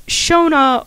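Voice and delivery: Shona, two syllables, starting with sh; the Gaelic reading, Shona; correct name pronunciation. Shown as a demonstration. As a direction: say Shona as SHOH-nah, stress on the first syllable. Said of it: "the correct pronunciation of the name is".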